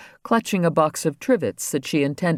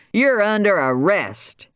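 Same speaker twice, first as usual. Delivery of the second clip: shouted arrest; 7 semitones higher, slower than usual. A narrator's reading. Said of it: "Andy shouted".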